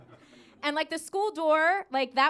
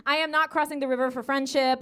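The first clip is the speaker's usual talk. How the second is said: usual speaking voice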